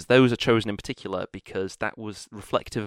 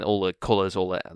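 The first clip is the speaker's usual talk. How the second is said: ordinary talk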